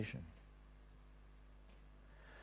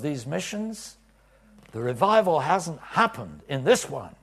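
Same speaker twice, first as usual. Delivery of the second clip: raised, pressing plea to the Lord; loud pleading prayer; 7 semitones higher, 12 dB louder than usual. Retold or imitated. Preacher retelling his prayer, loud pleading. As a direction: press God hard, voice raised high.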